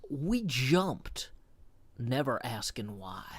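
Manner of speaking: measured recital with drawn-out phrases and pauses; dramatic lyric reading. Acted. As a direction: recite the love lyrics like a solemn monologue.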